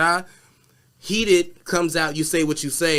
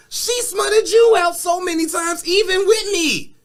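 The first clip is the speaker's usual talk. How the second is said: acted yelling; 8 semitones higher than usual. Voicing Tory's angry rant.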